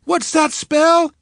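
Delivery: high, slow, loud cheer; cheerleader call. Acted. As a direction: stretch it out for the bleachers. Cheerleader calls to crowd.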